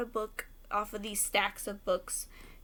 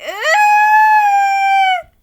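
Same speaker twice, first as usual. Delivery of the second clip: shrill, very high and loud fake sob-wail; mock crying wail; 20 dB louder than usual. Pretending to weep over Newt, loud mock wail. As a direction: wail like a heartbroken fan. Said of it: "That was a really ugly crying face".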